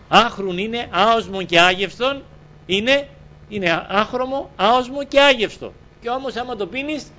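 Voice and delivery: high, ringing sermon delivery; impassioned preaching. Real, not acted. Preacher tells congregation what water is like, impassioned. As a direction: preach it in high, emphatic phrases.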